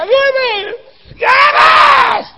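imitated high-pitched, frantic holler; shouted call. Waiting teammate hollering for the stalled runner, shouted call.

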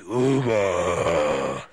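booming, very deep mock-divine voice; Dios. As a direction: call the name low, loud and drawn out like a movie deity. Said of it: "¿Quién dijo que Dios habla así?". (voz divina exagerada, grave y retumbante)

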